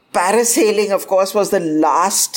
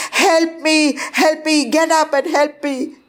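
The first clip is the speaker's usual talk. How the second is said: high insistent cry; urgent pleading; 10 semitones higher than usual. A narrator's reading. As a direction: plead over and over. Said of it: "But it wasn't my voice".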